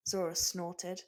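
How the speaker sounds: ordinary delivery, nothing marked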